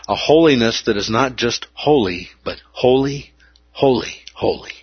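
holy, holy, holy, each one leaned on; repeated holy, last one fading; triple emphasis. Shown as a demonstration. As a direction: stress each holy in turn. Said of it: "A triple emphatic".

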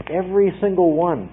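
ordinary delivery

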